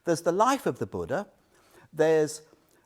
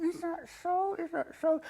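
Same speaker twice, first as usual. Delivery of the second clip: doubting; 13 semitones higher than usual. Retold or imitated. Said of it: "you think".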